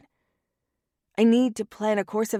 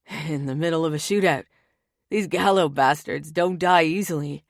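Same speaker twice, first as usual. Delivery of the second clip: brother in a shootout; 3 semitones lower, 4 dB louder than usual. Acted. Tony mid-shootout on phone.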